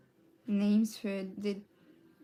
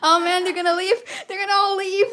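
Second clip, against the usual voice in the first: higher, louder mock alarm; mock panic; 8 semitones higher, 9 dB louder than usual. Real, not acted. Worrying new viewers will leave, mock panic.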